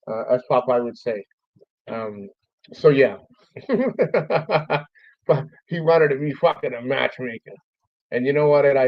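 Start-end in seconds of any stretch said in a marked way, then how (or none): 3.5-4.8 high-pitched run of chuckles; amused high giggle; real chuckle. Real, not acted. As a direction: chuckle to yourself at the memory. Laughs after own retold joke.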